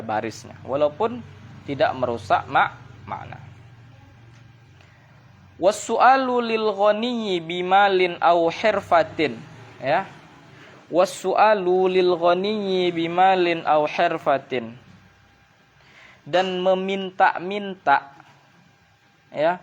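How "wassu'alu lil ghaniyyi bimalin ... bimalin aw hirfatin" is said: slow, measured reading of the Arabic text; text recitation. Acted. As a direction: recite the passage deliberately.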